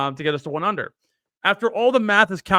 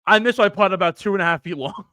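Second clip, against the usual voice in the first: normal talking voice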